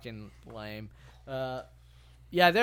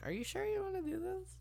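mock high voice; 7 dB quieter than usual. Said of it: "she's like".

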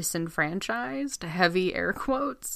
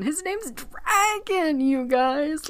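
excited; 9 semitones higher, much slower than usual. Real, not acted. Marvelling at the name.

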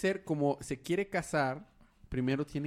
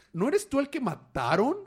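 mocking jab; 12 semitones higher, 7 dB louder than usual. [name] fires back at Jason.

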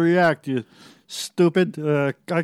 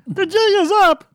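high, loud frantic squeal; panicked fridge; 14 semitones higher, 4 dB louder than usual. Acted. Exposed fridge begging to escape, high and loud. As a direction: shriek to get out.

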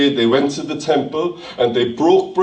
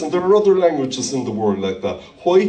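ordinary talk, nothing marked

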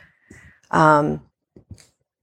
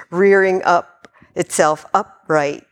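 ordinary delivery

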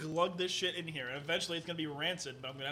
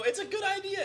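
high-pitched mock-eager imitation; squeaky mock endorsement of a bad plan; mocking enthusiasm; 4 dB louder than usual. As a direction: mimic gullible friends in a much higher voice. Mimicking friends endorsing a bad idea.

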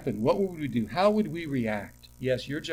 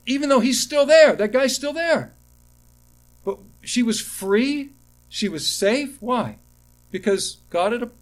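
high, loud pulpit voice, swelling then settling; preaching at full force; 7 semitones higher, 6 dB louder than usual. Acted. Pastor driving home the daughter story, preaching at full force. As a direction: lift the room with the point of the story.

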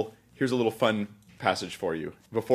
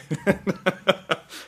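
a high burst of laughter; sudden high-pitched laugh; 9 semitones higher than usual. Real, not acted. Laughs at guest's reaction.

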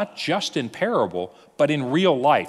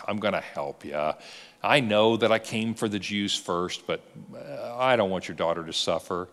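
much lower, softer tender reply; imagined Jesus softly comforting the woman; gentle reassurance; 5 semitones lower, 3 dB quieter than usual. Retold or imitated. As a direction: comfort her quietly.

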